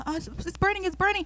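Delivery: put-on a girl in the chair squealing to the stylist; high whiny complaint. Girl in the stylist's chair complaining, high and whiny. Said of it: "I would sit there and tell them".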